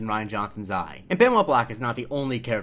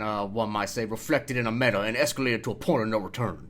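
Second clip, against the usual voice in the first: Benoit Blanc. Acted. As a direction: imitate an orotund Southern gentleman's accent. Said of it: "Benoit Blanc voice".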